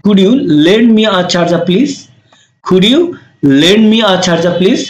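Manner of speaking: slow, careful English request; slow polite model. Shown as a demonstration. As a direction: say it slowly for learners. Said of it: "তখন বলছে কি". (ধীরে, ভদ্রভাবে অনুরোধ)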